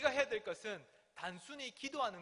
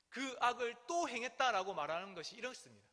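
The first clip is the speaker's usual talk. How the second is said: ordinary delivery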